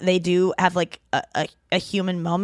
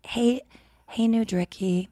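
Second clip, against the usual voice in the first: failed ASMR voice; 2 semitones higher, 6 dB quieter than usual. Acted. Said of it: "I can't even do it".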